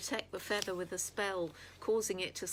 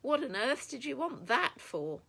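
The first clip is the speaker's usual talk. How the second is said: high, louder disbelieving question; incredulous; 6 semitones higher, 5 dB louder than usual. A narrator's reading. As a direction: demand it in piping disbelief. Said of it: "Yulia piped up incredulously".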